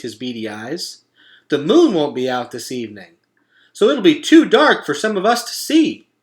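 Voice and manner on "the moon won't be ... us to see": higher, louder eager animal voice; a friendly critter raising a problem; beaver voice. A narrator's reading. Mister Beaver warns Roz about the dark, worried but friendly.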